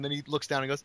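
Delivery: ordinary talk, nothing marked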